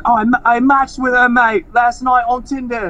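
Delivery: imitating an Australian guy bragging; Australian accent. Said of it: "your Australian accent is also on point".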